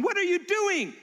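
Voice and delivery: high, loud mock alarm; alarmed friends. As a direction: cry out at someone near a cliff. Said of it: "everybody in their world's going".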